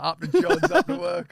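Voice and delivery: high, loud cab driver impression; cabbie catchphrase impression. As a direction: belt it out like a London cabbie. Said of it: "he's got these great catchphrases like".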